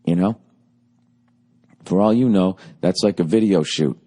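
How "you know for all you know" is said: normal talking voice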